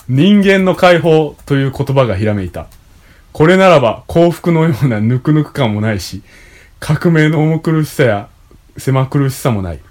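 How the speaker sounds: loud, clear reading of a text; reading aloud. Acted. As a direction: read it out like an essay. Reads a passage aloud.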